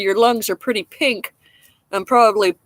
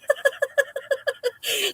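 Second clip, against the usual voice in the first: high, soft run of laughter; real giggle; 7 dB quieter than usual. Real, not acted. Smoker recalling tobacco claim, helpless soft laugh. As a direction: break off mid-sentence to giggle.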